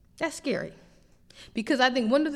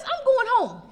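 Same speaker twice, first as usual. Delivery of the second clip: high, sure of yourself; breezy, certain, pitched up; confident driver; 12 semitones higher, 4 dB louder than usual. Retold or imitated. Driver sure of the way, lightly voiced high confidence. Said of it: "You know how you drive".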